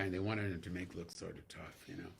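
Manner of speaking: ordinary delivery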